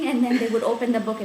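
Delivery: ordinary delivery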